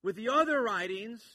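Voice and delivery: loud, high and slow; forceful emphasis. Retold or imitated. Preacher speaks as John to his readers, loud and emphatic. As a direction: drive it home to the back row. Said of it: "That's his emphasis".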